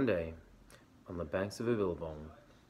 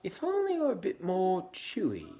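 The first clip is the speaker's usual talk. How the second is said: much higher, loud wistful; sighing Emu; 11 semitones higher, 5 dB louder than usual. Acted. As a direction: pitch it way up and sigh it. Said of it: "she sighed".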